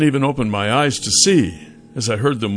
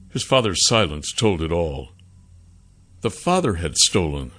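ordinary delivery

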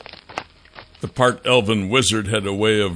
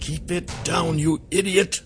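hissed through the teeth, soft and sharp; angry hiss; 8 semitones higher, 4 dB quieter than usual. Acted. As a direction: scold him under your breath. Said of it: "I hissed through my teeth".

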